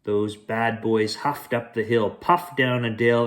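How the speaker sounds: plain reading voice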